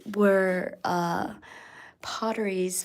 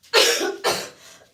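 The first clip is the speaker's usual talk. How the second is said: rough chesty cough; sick cough; 8 dB louder than usual. Real, not acted. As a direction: cough hard, sick with a cold. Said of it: "And I'm sick".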